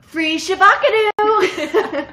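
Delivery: high, loud, stretched-out yell; hammed-up Vine shout. Quoting a favorite Vine to co-host, comic shout. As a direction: holler it slowly through laughter.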